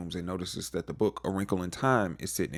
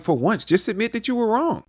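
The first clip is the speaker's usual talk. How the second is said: much higher, louder mock lecture; arrogant scolding; 12 semitones higher, 5 dB louder than usual. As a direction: scold him like you're always right. Steve lecturing Dustin arrogantly.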